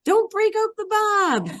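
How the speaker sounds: high, sing-song teacher slogan; classroom catchphrase. Retold or imitated. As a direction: chirp it like a rule the kids chant back. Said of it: "I always tell my students".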